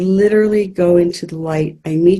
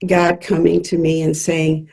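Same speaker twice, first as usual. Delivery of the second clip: ordinary talk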